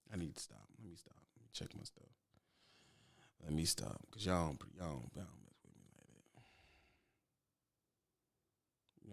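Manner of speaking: going quiet. Real, not acted. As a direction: drop to a hush and mumble it away. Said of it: "I'm gonna be quiet".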